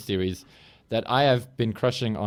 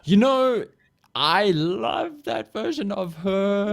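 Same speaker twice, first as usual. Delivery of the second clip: mock high voice; 8 semitones higher, much slower than usual. Mocking Paul's opinion in falsetto.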